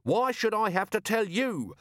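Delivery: high-pitched exasperated rant; livid. A narrator's reading. Vimes berating his useless organizer, exasperated rant. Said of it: "that's what drives me livid".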